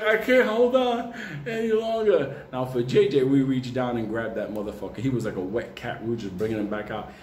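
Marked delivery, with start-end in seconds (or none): 0.0-2.4 mock weak plea. Friend clinging under icy bridge.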